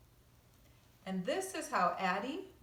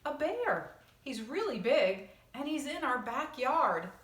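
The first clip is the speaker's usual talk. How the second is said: put-on higher, louder eager boy voice; excited boy; 5 semitones higher, 6 dB louder than usual. Carl insisting to Michael about the bear, excited. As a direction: insist it's really true.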